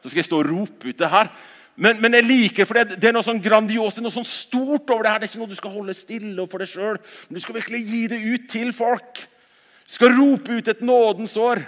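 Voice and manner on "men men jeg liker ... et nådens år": loud, high ringing preacher's call; forceful preaching. Acted. Pastor rousing the congregation to go out, forceful preaching. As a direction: proclaim it to the whole hall.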